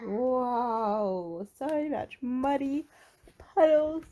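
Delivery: high drawn-out amazement; amazed delight. A narrator's reading. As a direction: marvel loudly, stretching the words. Marvelling at the huge puddle.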